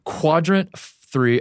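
very slow, deliberate enunciation; teasingly drawn out. Pretend. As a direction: stretch each word to tease your co-host. Teasing laughing co-host with trigger word.

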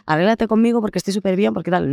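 lightly mocking a chirpy influencer; fake cheery influencer. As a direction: parody the always-happy get-ready-with-me girl. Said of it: "poniéndome a decir".

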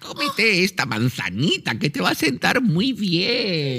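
put-on high, creaky old hag offering an apple; sweet-talking evil witch, pitch way up. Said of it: "qué bruja".

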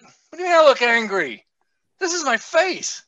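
indignant retort. Retold or imitated. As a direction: push back, offended. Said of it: "And I'm like".

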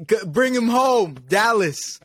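high drawn-out yell; mock shout. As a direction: call it out like a fan. Mock cheer for Dallas homecoming.

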